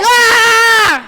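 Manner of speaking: high shouted "Ah!"; high startling shout; scare yell. Acted. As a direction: bellow it to make them jump. Storyteller jump scare.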